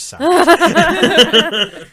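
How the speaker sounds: breaking into laughter mid-sentence; laughing. Real, not acted. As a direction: laugh the words out, slowly and loudly. Joking about mother's family blame.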